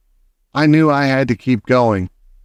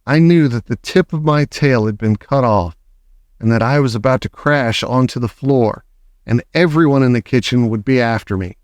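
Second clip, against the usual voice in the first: usual speaking voice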